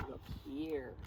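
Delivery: usual speaking voice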